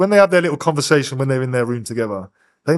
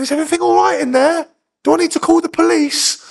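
put-on high, louder old lady voice, fussing with concern; worried old woman; 4 dB louder than usual. Old woman at the door offering to call police, high and fretful. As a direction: play the concerned old neighbour. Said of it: "She's going".

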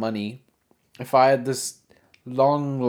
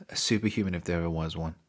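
ordinary talk

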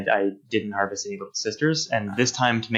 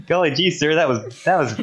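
put-on high, loud gee-whiz kid voice, laughing; wholesome 1950s kid; 6 semitones higher, 3 dB louder than usual. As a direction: ham up an old-timey polite boy. Mocking an innocent game character.

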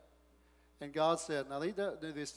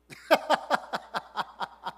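laughing aloud; 6 semitones higher, 7 dB louder than usual. Real, not acted. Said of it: "getting a little excited".

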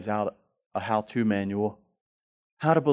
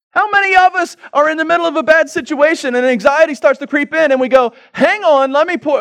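high, loud, mounting mock panic; mock anxious spiral; 14 dB louder than usual. Pastor playing a worried believer's racing thoughts, in mock panic. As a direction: rattle off the worries faster and higher. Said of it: "acting out anxious spiraling thoughts".

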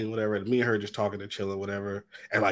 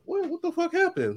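retold surprise; 3 dB quieter than usual. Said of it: "And I'm like".

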